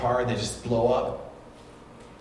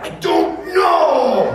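put-on a slow, drawn-out, much higher and louder yell of a sulky child; frustrated kid's shout; 10 semitones higher, 11 dB louder, much slower than usual. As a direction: blurt it out like a mad little boy who can't explain why. Angry kid answering his mom.

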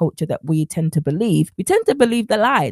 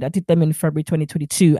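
ordinary delivery

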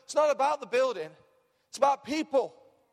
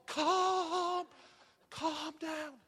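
higher, soft, stretched-out hushing; put-on soothing that fades away softly; mock placating; 5 semitones higher, 5 dB quieter, much slower than usual. Killjoy cooling excitement about Acorns Kids, mock-soothing. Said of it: "You know, people just".

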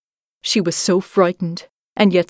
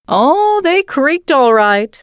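high croaking crow voice; a knowing bird, voice lifted high; raspy cawing crow; 7 semitones higher, 4 dB louder, slower than usual. A narrator's reading. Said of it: "said the crow".

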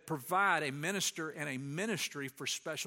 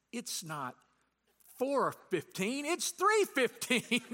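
amused realization; 6 semitones higher, 4 dB louder, slower than usual. Real, not acted. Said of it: "I realize".

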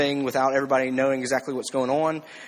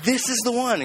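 excited retold quote, much higher pitch; excited Samuel; 8 semitones higher than usual. Preacher acting out Samuel picking a king, excited. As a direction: imitate someone thrilled at his pick, pitched up high. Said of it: "Samuel gets all excited. He's like".

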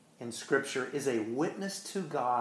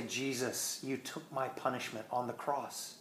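softer, tender words to Jesus; quiet grateful prayer; 7 dB quieter than usual. Pretend. Preacher modelling what a believer says to Jesus, quiet and tender. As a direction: speak it like a hushed personal prayer.

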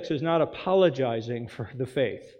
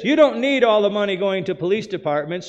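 quoting governor; 5 semitones higher, 7 dB louder than usual. Retold or imitated. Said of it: "she said this".

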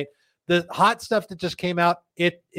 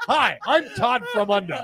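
mock pitchman intro; 6 semitones higher than usual. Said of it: "like the My Pillow guy".

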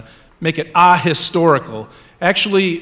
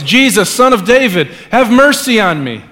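raised, higher crying out; loud call; 6 semitones higher, 6 dB louder than usual. A narrator's reading. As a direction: call out to be heard over a crowd. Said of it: "he called out to him in a loud voice".

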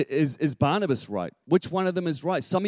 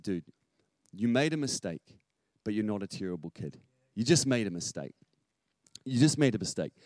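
softer, lowered fatherly comfort; gentle reassurance; 4 semitones lower, 5 dB quieter than usual. Retold or imitated. Father reassures son who flooded the bath, gentle. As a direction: let him down gently, with pauses.

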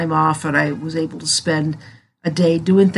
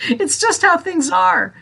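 laughing; 11 semitones higher than usual. Real, not acted. Amused shrug at life's setbacks.

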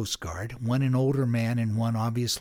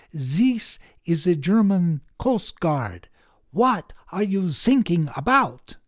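slow, higher mock German accent, a little louder; a young German coastguard answering the radio in a put-on German accent; 6 semitones higher, 6 dB louder, slower than usual. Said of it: "the young German Coast Guard man ... says".

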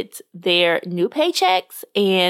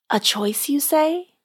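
croaking; 5 semitones higher than usual. A narrator's reading. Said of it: "Tanya croaked".

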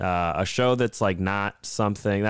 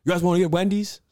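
high, eager friend's voice; playful mock of a friend offering food; imitating C Money; 10 semitones higher, 3 dB louder than usual. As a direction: pitch it up like an excited buddy. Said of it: "He's like".

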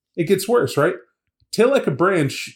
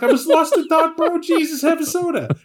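mock startled bro. Imagined friend, startled reply.

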